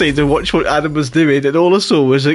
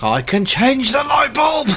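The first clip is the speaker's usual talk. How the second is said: higher, slow hammed-up yell; mock shout; 9 semitones higher than usual. Gamer voices the character's triumph in a mock shout. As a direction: belt it like a proud goof. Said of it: "It's like".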